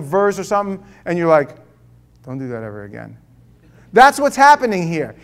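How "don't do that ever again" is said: low, soft deadpan warning; hushed comic plea; mock unsettled aside. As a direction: mutter it low, as if spooked. Acts out unsettled person after prayer.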